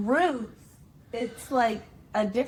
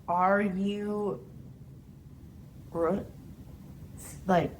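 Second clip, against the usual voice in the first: slow spelling; much slower than usual. Shown as a demonstration. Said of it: "spelling out letters".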